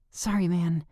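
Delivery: airy voice. A narrator's reading. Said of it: "she says in an airy voice".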